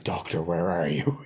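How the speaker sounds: lightly voiced villain, low and slow; retold villain's call. Quoting a film villain's call.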